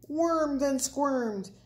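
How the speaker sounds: ordinary delivery, nothing marked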